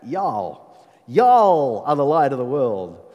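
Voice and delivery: slow, hammed-up Southern American drawl; Southern US accent. Shown as a demonstration. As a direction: put on a big, drawn-out Southern US twang. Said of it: "that sort of southern U S".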